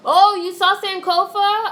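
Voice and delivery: ordinary talk, nothing marked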